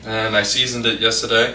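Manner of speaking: ordinary talk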